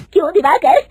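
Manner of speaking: mother-in-law confronting the cheating manager, loud and angry. Acted. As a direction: confront him furiously. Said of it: "गुस्से से दिवाकर को पूछती है".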